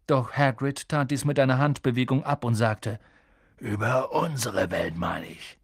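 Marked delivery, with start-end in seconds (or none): 3.6-5.6 slow, low, husky giant voice; gruff giant. A narrator's reading. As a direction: rumble it out slowly. Hagrid explaining, low husky giant.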